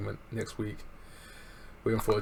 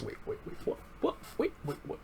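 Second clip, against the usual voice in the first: stunned whisper; 5 semitones higher, 7 dB quieter, faster than usual. Retold or imitated. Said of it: "Swerve goes".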